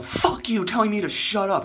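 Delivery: high, loud mock defiance; rebellious retort. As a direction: imitate a defiant man shouting back. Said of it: "now he's rebellious".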